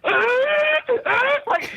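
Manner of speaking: high, squealed pained whimper; imitated crying groan. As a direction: mimic his yelp, very high. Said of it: "looks at me and goes".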